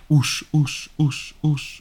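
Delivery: repeated syllable fading away; echo. Shown as a demonstration. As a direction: bounce the name's last syllable back, dying out. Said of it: "a potem echo odwołowe-, odpowiada".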